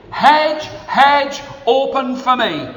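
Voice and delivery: high, loud "Hedge, hedge"; the old man teaching the girl the spell, loud; magic command. Acted. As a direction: call the spell out.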